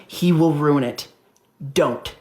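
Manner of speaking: slow, low, final warning; grave and deliberate; stern warning. Retold or imitated. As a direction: lay down a warning, dropping low. Manager's stern business warning.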